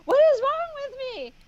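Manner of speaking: very high, fast, comic exclamation; mock exasperation. Laughing at her own ignorance, high mock exasperation. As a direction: squeal it at yourself. Self mockery.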